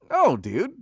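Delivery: loud, high-pitched "oh, dude"; mock someone caught off guard; imitated awkward reaction.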